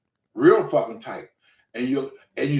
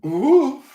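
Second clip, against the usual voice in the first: loud, high "ooh" of effort; strained groan; 11 semitones higher, 5 dB louder than usual. Retold or imitated. Beginner heaving at the cinder-block bar, strained. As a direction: push out a pained high huff. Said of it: "tried to bend down and grab that".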